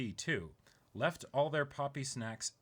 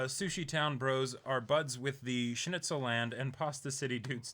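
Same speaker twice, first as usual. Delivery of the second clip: ordinary talk, nothing marked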